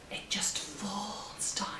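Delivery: soft unvoiced whisper; whispered aside. Real, not acted. Whispered remark.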